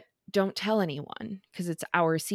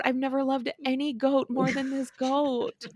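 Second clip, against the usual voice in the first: besotted with the goat; 6 semitones higher than usual. Retold or imitated. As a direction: gush over the goat like it's a baby. Adoring the goat, retold.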